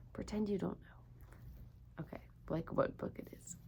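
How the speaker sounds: hushed, voiceless aside to the viewers; whispered aside. Real, not acted. A teacher whispers an aside to her young viewers. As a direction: drop to a whisper for a quick aside.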